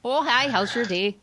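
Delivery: sing-song friendly Minnesota greeting; mocking the Minnesota nice welcome in a Minnesota accent. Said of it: "That's my Minnesota accent".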